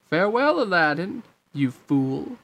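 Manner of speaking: high lilting farewell, falling onto the insult; mocking villain. Jafar leaves Aladdin defeated, mocking. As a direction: taunt with a sing-out goodbye.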